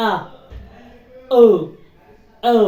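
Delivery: "ও" sounded out alone, set apart by pauses; phonic O sound. Shown as a demonstration. Teacher showing learners the phonic sound, "ও" sounded out alone. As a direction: demonstrate "ও" slowly as an isolated vowel. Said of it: "আর ফনিক sound".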